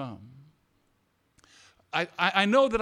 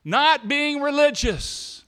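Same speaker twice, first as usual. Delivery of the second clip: louder, higher, rousing the room; full-force preaching; 4 semitones higher, 5 dB louder than usual. Acted. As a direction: build it like a pulpit climax.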